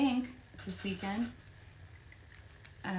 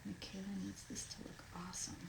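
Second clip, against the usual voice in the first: whisper; 13 dB quieter, faster than usual. Real, not acted. Whispering to herself while signing.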